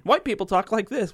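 lightly hammed-up comedian setting up a contrast, pitched well up; stand-up comic bit. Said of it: "like a stand-up comedy routine".

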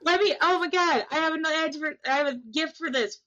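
giddy, high-pitched rush of words; excited. Real, not acted. Player finding a gift to use so soon, excited. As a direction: gush with real, high-voiced excitement. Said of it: "I'm so excited right now".

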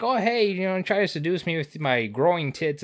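high, hammed-up flirty voice; mock seductive pig. Recapping the pig's seduction, high and hammed up. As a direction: camp it up as the horny pig. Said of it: "He's like".

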